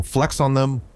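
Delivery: high, grinning battle order; yelled with a smile. Acted. Iron Arms ordering his wizards, a grinning yelled command. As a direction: give the order, smiling. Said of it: "Iron Arms yelled with a smile".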